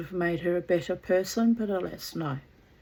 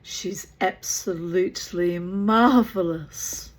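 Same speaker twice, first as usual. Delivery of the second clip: slow, loud, drawn-out gush; Margot, gushing; 8 dB louder, slower than usual. A narrator's reading. Daughter praising her guest to Mother, dramatic slow gushing. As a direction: stretch the praise out theatrically. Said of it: "said Margot dramatically".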